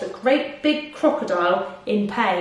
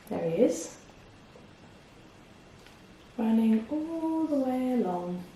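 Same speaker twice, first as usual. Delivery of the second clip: much softer, almost whispered; hushed aside; 9 dB quieter than usual. Real, not acted. Reader to listeners leaning closer, hushed. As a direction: lean in and hush. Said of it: "gonna come a bit closer".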